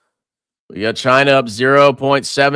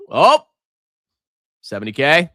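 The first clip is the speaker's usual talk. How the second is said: normal talking voice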